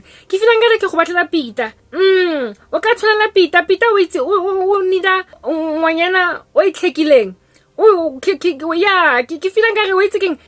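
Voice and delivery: high, loud cooing mother; mother picks the girl for her son Peter, high and loud; gushing matchmaker mom. Retold or imitated. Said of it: "I said his name was Peter".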